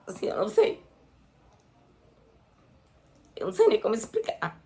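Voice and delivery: real tears catching in the throat; fighting back tears, voice breaking; choked up. Real, not acted. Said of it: "Desculpa, gente". (emocionada, com a voz embargada)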